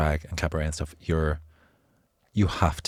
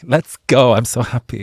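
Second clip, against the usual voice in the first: loud, higher burst of mock enthusiasm; excited; 5 semitones higher, 12 dB louder than usual. Imitating a guest who came in so excited, loud and thrilled. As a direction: gush it like an eager arrival. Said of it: "you came in so excited".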